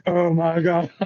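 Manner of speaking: high, sinking realization; mock dismay. Marine looks down at the wrong name tapes, mock dismay. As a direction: play up the embarrassed horror.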